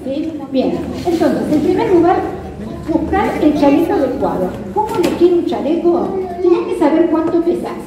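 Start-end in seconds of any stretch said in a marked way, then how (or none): none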